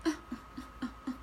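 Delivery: soft, weary little groans; small spent grunts, trailing away; reluctant grunts. Acted. Reader mimicking worn-out firefighter, reluctant grunts. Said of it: "that's that energy, like".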